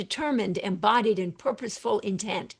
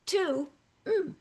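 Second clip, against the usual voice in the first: bright hum; 8 semitones higher, 3 dB quieter than usual. Shown as a demonstration. To new good feeling.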